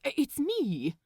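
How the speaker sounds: overly proper, a bit rattled; flustered Aziraphale. Acted. Aziraphale on phone.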